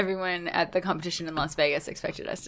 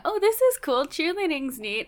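casual mild interest; 8 semitones higher than usual. Retold or imitated. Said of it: "they're like".

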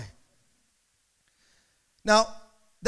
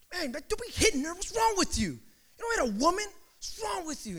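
high-pitched rebuke without raised volume; retold indignant scolding; 8 semitones higher, 4 dB quieter than usual. Preacher retelling rebuking the man for hitting her, indignant scolding. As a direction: act out telling someone off, incredulous.

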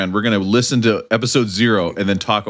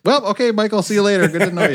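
mock dismissive producers; 9 semitones higher than usual. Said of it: "And they're like".

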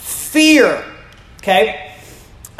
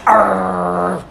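low playful growl like a small dog guarding its bone; 4 semitones lower than usual. Retold or imitated. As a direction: growl like the Yorkie protecting his bone. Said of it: "and he'll go".

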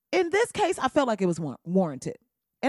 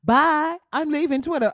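mock farewell; 5 semitones higher, 4 dB louder than usual. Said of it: "big announcement".

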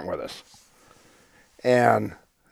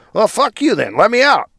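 high, louder demand to get out; mock furious; 10 semitones higher, 5 dB louder than usual. Drunk man to wife, demanding out of the Jeep, high angry. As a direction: storm out in a huff.

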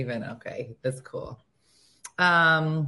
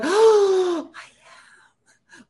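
high gasp then whispered reply; touched mom; 12 semitones higher, 6 dB louder, much slower than usual. Retold or imitated. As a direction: overcome with mock emotion. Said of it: "you're like".

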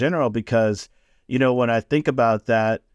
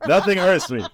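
higher, hammed-up unfeeling macho man; mock tough guy; 7 semitones higher than usual. Mocking his younger self to his partner, hammed-up macho voice. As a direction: play a stoic man who insists nothing hurts. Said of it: "and I'm like".

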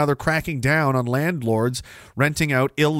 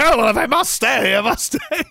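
high, loud mock reluctance; willing knight; 9 semitones higher, 5 dB louder than usual. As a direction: give in with hammed-up eagerness. Acting knight giving in.

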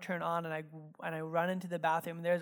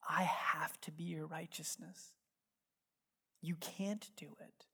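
whisper; 3 semitones lower, 10 dB quieter than usual. Acted. Jesus's words, voiced tenderly.

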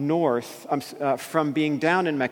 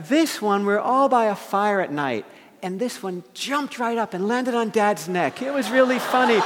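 put-on high, excited girlish storytelling; put-on bubbly teen voice; imitated teenage daughter; 7 semitones higher than usual. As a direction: mimic a girl gleefully telling her tale. Daughter excitedly tells spider story.

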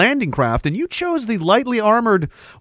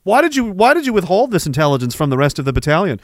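ordinary talk, nothing marked

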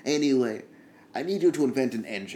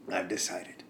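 whisper; 6 semitones lower, 8 dB quieter than usual. Acted. Whispered resolution mid-improv.

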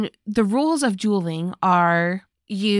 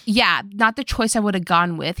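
normal talking voice

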